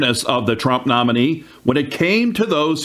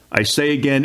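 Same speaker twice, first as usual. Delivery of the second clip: ordinary delivery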